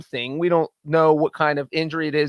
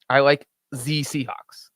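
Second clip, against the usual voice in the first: slow, lightly faked German accent; a hint of hammy German on the article; mock German accent; 3 semitones lower, slower than usual. Sports host closing his Munich game pick, mock German accent.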